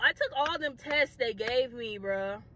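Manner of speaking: normal talking voice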